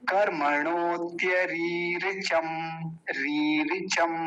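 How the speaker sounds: रीरिचम with a long first "ree" and quick short "ri"; slow careful demo, long री before short रि; long then short vowel. Shown as a demonstration. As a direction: hold री, then clip रि in रीरिचम. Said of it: "पहली लंबी बोली जाएगी, दूसरी जल्दी".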